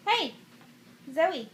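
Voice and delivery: ordinary delivery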